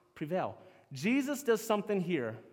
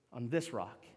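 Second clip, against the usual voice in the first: "on this rock" repeated much lower and softer; soft echo; 8 semitones lower, 7 dB quieter than usual. Shown as a demonstration. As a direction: murmur "on this rock" back to yourself, falling low.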